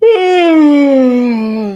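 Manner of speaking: long loud high wail of boredom; loud exasperated howl; anguished cry. Real, not acted. Bored watching the market.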